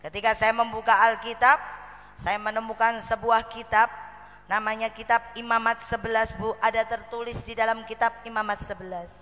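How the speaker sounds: usual speaking voice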